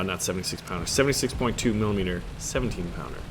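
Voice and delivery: normal talking voice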